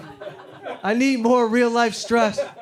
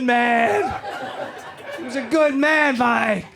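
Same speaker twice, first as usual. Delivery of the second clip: put-on emotional drunk outburst; 4 semitones higher than usual. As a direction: pour your heart out, near shouting. Emotional whiskey outburst to Mike.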